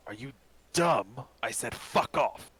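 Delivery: irritated, punchy warning; angry contempt. Acted. Human to a meddling stranger, contemptuous anger. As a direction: hit the insult hard, then draw out the dismissal.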